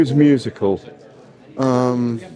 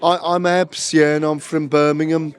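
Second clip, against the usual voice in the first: higher, lightly mocking Brummie accent; 3 semitones higher than usual. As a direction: tease him with a cheeky Brummie accent. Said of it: "I mocked your accent like that".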